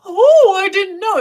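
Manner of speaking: high, hammed-up "oh" of mock innocence; dramatic fake surprise. Worker caught at the window pretending innocence, dramatic fake surprise. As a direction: imitate someone badly faking shock, starting loud. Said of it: "he does this dramatic".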